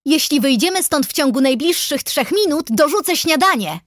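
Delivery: high-pitched mild yell; yelling back. A narrator's reading. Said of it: "wrzasnęła".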